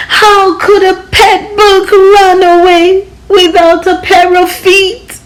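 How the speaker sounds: mock bleating. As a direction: bleat it out like a crying kid. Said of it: "The boy began to bleat".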